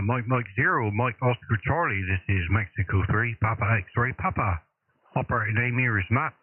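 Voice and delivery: ordinary delivery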